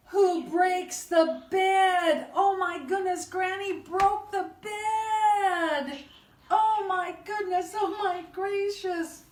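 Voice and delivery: high, slow, big storytime climax; loud, high, hammed-up astonishment; mock shock. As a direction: exclaim in drawn-out pretend horror for kids. Story climax, bed breaks.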